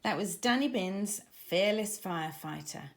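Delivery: plain reading voice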